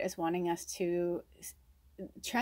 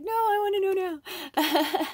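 put-on much higher, louder whiny kid; impatient child; 12 semitones higher, 5 dB louder than usual. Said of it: "the child that's like".

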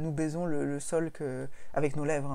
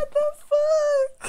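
drawn-out, much higher, louder outcry of disbelief; a long stunned yelp of disbelief; shocked outcry; 8 dB louder, much slower than usual. Real, not acted. Host reacts to the time-travelling Hitler spoiler with shocked disbelief.

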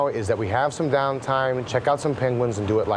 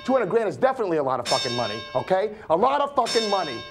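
pitched much higher, racing and wound up; manic rant; 10 semitones higher than usual. Acted. Man ranting about the money and the danger, manic and wound up. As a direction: spill it out like a paranoid man spinning. Long paranoid rant held high.

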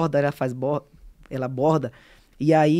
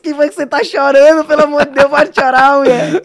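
loud, high-pitched comic panic; apavorado, encenado; 8 semitones higher, 13 dB louder than usual. Retold or imitated. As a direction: plead with her in mock alarm. Acts out his alarm at crying girl. (pânico cômico, em voz alta e aguda)

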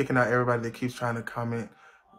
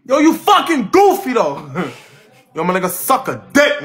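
much higher and louder playful yell; mock outrage; 10 semitones higher, 12 dB louder than usual. Real, not acted. Streamer yells at friend in playful mock outrage. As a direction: holler at your friend in fake offense.

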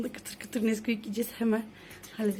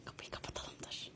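whispering; 17 dB quieter than usual. Real, not acted. Sneaking to eat cereal silently.

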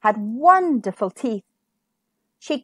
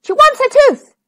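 high, loud cry of alarm; 13 semitones higher, 9 dB louder than usual. A narrator's reading. Maid relaying the princess's demand for her tooth, crying out. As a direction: call it out urgently, pitched high. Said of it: "cried the maid".

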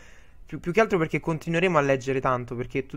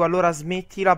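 quoted stern order; 3 semitones higher, 7 dB louder than usual. Retold or imitated. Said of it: "se gli dico".

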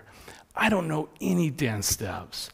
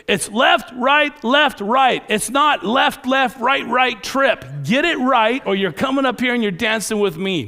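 loud, high scolding drill shout; coach yelling; 9 semitones higher, 8 dB louder than usual. Retold or imitated. Gridiron coach correcting a boy in front of everybody, yelling. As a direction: yell the correction and the threat. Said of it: "And then he yells".

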